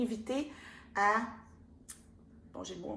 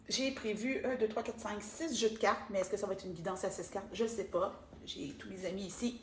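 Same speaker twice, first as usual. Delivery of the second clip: usual speaking voice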